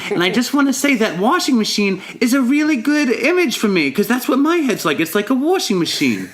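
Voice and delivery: put-on high-pitched old lady testifying; old woman. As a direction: imitate an elderly woman at a meeting. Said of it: "this old woman raised her hand".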